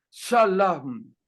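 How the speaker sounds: Shalom said sha-LAM, with a for o; crude pronunciation. Shown as a demonstration. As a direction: sound out Shalom as sha-laam. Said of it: "una traducción burda, sonaría".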